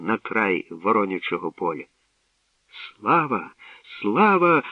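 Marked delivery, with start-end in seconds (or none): 2.7-4.6 jubilant cheer, second cry higher; rising shouts of joy; joyful cheering. A narrator's reading. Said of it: "голосувала Мирося, аж підскакуючи на могилі від радощів".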